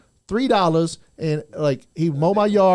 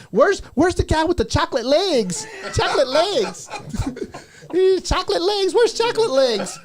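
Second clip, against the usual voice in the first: put-on higher, laughing kid voice; little son voice; 11 semitones higher than usual. Father imitating his little son asking for the chocolate guy, high and giggly. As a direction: mimic a giggling little boy calling for Penny. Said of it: "my son was always like".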